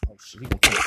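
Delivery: loud panicked cry; terrified of dying; being chased. Real, not acted.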